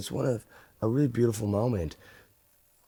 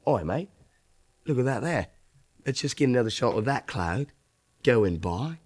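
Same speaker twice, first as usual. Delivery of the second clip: imitated Aussie cameraman, slightly raised; Australian accent; 4 semitones higher than usual. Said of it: "in his Australian accent".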